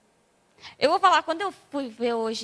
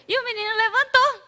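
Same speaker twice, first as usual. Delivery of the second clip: "levantou" lifted high and louder; excited retelling; 8 semitones higher, 4 dB louder than usual. Shown as a demonstration. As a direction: burst out with the miracle. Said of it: "repeated emphatically". ("levantou" dito alto e empolgado)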